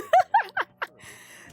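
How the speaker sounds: high shrieking burst of laughter. Real, not acted. Reacts to creepy voice clip.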